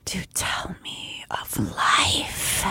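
soft echoing dream voice. Acted. The creature in the dark.

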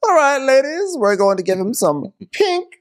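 high, hammed-up grande dame; Karen. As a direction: announce it theatrically in a high voice. Co-host plays Karen impression.

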